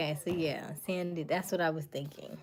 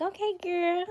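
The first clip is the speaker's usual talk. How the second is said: much higher, louder mock hype; hyping up bestie; 9 dB louder, slower than usual. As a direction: cheer on a best friend, gushing.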